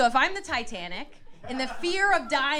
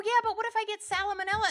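worried high; 11 semitones higher, faster than usual. Retold or imitated. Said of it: "And I go".

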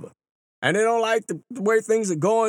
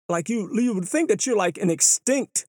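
ordinary delivery

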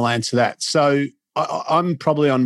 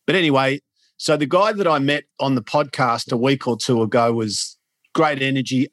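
usual speaking voice

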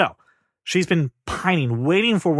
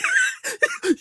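very high mock cackle; squealed fake belly laugh; hysterical laughing. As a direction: imitate a room in hysterics. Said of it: "everyone's hysterically laughing".

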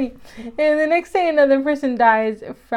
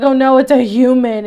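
mock dramatic reveal; 9 dB louder than usual. Mocking show's twist.

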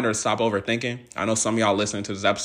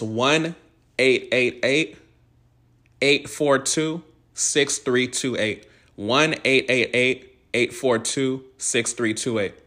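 reading digits out slowly and clearly; slow dictation; 3 semitones higher, much slower than usual. Shown as a demonstration. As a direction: spell the number out, digit by digit. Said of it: "I'm gonna read the number".

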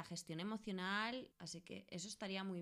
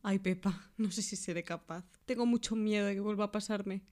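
acted fear; 8 dB louder than usual. Acted scene, fearful patient.

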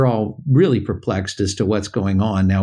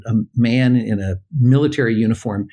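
usual speaking voice

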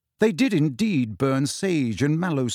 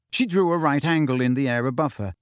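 usual speaking voice

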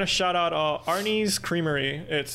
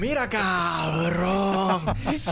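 slow, drawn-out Spanish jab; mock tough jab; 3 semitones higher, much slower than usual. Teasing the cohost with a slow mock-tough Spanish jab.

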